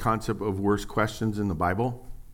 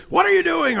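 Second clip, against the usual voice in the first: much higher, louder scolding snap; a harsh dad scolding his son; angry dad; 8 dB louder than usual. Retold or imitated. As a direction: bark it like a dad catching his kid. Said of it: "Never started with".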